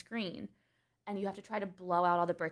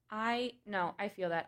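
usual speaking voice

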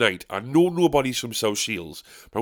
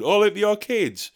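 mock heckler; 8 semitones higher than usual. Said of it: "interrupting halfway through going".

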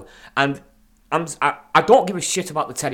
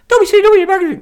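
very high, loud squealed advert line; woo voice; 9 dB louder than usual. Acted. Host botching a wrestler impression for a magazine advert, woo voice. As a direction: squeal it out like a wrestling hype man. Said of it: "Why am I doing it in the woo voice?".

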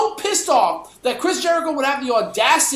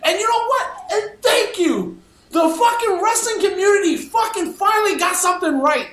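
higher, shouting with glee; excited shouting; 7 semitones higher than usual. Real, not acted. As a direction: cheer it out like a win. Triumphant outburst about community.

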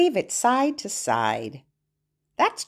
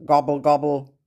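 low, lightly voiced turkey gobble; a hint of a gobbling turkey; 6 semitones lower than usual. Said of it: "Let's hear Turkey again".

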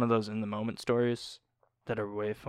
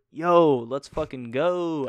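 hyped; 4 semitones higher, 8 dB louder, much slower than usual. Retold or imitated. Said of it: "I was like".

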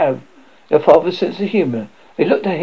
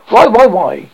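high, loud, insistent repeated why; shouting; 6 semitones higher, 8 dB louder, slower than usual. A narrator's reading. Father protesting that nobody cuddles him, shouting. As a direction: punch out each why in a shout. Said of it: "Not so loud".

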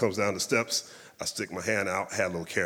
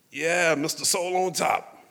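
higher, slow and knowing; 8 semitones higher, 3 dB louder than usual. Retold or imitated. [name] greets the bass player by nickname, slow and higher. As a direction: savour the nickname like a legend.

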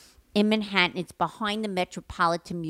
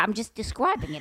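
ordinary delivery

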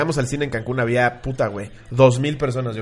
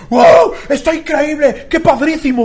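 very high, loud hammed-up awe; mocking fake excitement; 11 semitones higher, 7 dB louder, much slower than usual. Teasing screaming fans up close, mocking fake excitement. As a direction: gush in a silly, high shriek to tease. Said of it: "Cada vez que gritaban me acercaba a ellos y les decía".

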